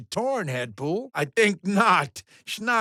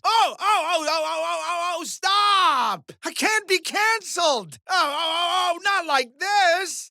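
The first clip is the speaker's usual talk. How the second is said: high, frantic begging whimper; panicked whine; 10 semitones higher than usual. Acted. Host panics about being canceled.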